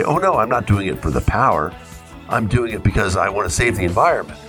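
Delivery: ordinary talk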